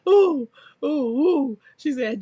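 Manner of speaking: usual speaking voice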